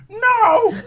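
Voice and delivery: very high, loud cat-like No. Retold or imitated. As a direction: yowl it like a startled cat. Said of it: "And he goes".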